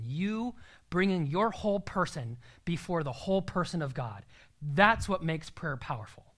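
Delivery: ordinary talk, nothing marked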